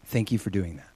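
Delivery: low, very soft thanks; quiet gratitude. Retold or imitated. Student thanks classmate after class, quietly grateful. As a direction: confide thanks gently.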